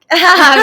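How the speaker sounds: high, loud burst of laughter; demo laugh. Shown as a demonstration. Guest shows the host her constant laugh on socials, high and loud. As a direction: show off the laugh she always does. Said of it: "I'm gonna be all the time like".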